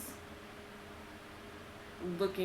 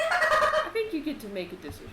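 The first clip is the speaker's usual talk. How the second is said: teasing reveal; 10 semitones higher, 4 dB louder than usual. Acted. GM ominous reveal.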